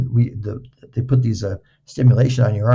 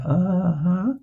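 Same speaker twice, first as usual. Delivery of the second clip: higher, rising put-on uh-huh; humouring uh-huh; 6 semitones higher, 4 dB quieter than usual. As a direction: play along doubtfully, voice lifting. Mock-agreeing to young therapist in story.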